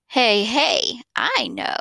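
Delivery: ordinary delivery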